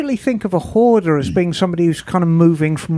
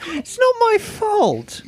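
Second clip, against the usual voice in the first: whiny excuse; 12 semitones higher, slower than usual. Pretend.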